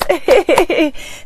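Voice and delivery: short high giggle; light, high laugh. Real, not acted. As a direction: giggle in a quick bubbly ripple. Laughs while greeting viewers.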